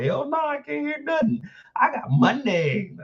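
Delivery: high-pitched mocking imitation; mock Kendall reply. Kendall answering from behind the collar, high mock voice. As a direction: pitch it way up, poking fun. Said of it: "She's like".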